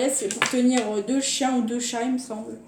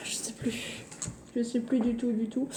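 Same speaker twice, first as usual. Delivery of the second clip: whispering; 10 dB quieter than usual. Real, not acted. Muttering to herself, forgetting.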